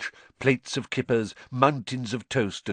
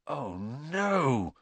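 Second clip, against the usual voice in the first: gasped; 4 semitones higher, 5 dB quieter than usual. A narrator's reading. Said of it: "Ron gasped".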